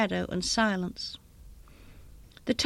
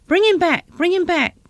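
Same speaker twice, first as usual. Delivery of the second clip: high, forceful, repeated cry; raised, impassioned pleading; desperate demand; 7 semitones higher, 5 dB louder than usual. A narrator's reading. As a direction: command it with a mother's desperation. Desperate mother demands son be revived.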